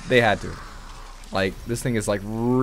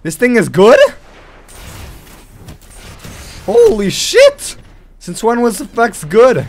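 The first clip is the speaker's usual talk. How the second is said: loud, high-pitched incredulous exclamations; stunned disbelief; 10 semitones higher, 10 dB louder than usual. Real, not acted. Gamer stunned that this thing is good, loud disbelief. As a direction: shout in real astonishment at what just happened.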